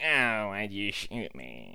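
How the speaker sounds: slow, low, soft mock groaning voice; mock shooting victim. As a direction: play a wounded man fading away. Imagines man who was just shot.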